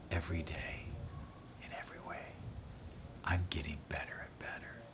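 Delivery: whispered softly to yourself. Shown as a demonstration. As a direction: breathe it as a secret to yourself. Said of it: "you just whisper to yourself".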